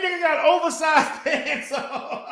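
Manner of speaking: slow, cracking up at the pants; laughing mockery. Real, not acted. Mocking the rival's pants, laughing.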